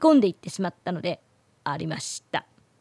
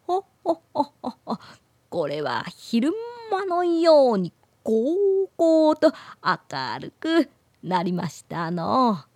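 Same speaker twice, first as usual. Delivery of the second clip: put-on creaky wise old man; 3 semitones higher than usual. Old man laughs and speaks.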